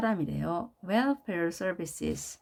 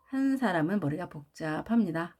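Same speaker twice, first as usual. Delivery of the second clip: ordinary talk, nothing marked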